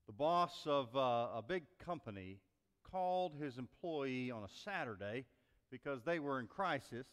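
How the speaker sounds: ordinary delivery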